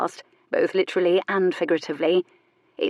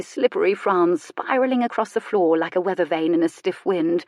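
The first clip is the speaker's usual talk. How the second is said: usual speaking voice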